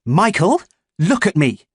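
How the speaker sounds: mother firmly calling her son. Acted. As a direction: demand he meet her eyes. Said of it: "repeating it more firmly".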